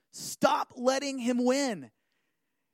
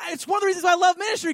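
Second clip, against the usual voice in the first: ordinary talk, nothing marked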